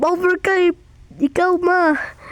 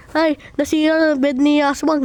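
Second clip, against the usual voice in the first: ordinary talk, nothing marked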